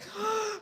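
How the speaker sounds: sharp high gasp of alarm; panicked gasp. Retold or imitated. Paranoid stoner suddenly panicking in the store, sharp gasp. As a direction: gasp as if caught out. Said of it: "I'm freaking out".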